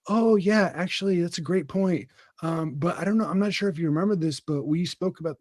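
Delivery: mock his own too-nice work voice; timid polite self. To his boss, a hint of meek, overly polite self. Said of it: "the normal Oathman is like".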